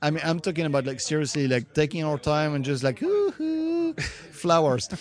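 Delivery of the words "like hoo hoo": playful hoo-hoo. Retold or imitated. Playful sound for relaxed drawing.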